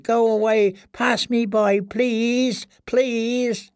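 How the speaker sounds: high, pleading prisoner's voice; desperate prayer. A narrator's reading. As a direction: beg to be passed by, pitched very high. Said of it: "he prayed".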